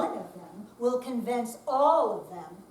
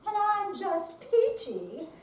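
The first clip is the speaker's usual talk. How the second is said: higher, sarcastic peachy; 8 semitones higher, 4 dB louder than usual. Acted. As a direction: drip irony on peachy. Sarcastic complaint.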